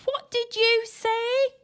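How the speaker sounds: put-on high, slow, loud old lady; high slow granny shout; shouting deaf granny. A narrator's reading. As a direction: bellow the question. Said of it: "she shouted".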